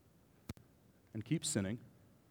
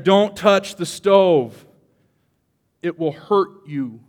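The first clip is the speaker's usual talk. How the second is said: slow, louder, higher stern mother warning; mother's warning; 4 semitones higher, 11 dB louder, much slower than usual. Retold or imitated. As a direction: drag it out like a mother scolding a child. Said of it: "It's a mother saying".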